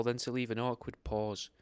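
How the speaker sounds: usual speaking voice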